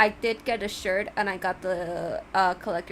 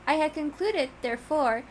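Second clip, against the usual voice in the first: the courteous cat, higher and startled; surprised cat; 6 semitones higher than usual. Acted. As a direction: let the grand host sound genuinely taken aback. Reads the Judge's dialogue.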